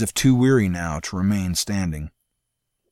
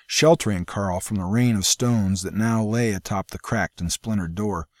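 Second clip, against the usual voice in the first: ordinary delivery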